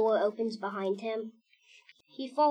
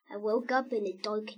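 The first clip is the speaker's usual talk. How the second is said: ordinary delivery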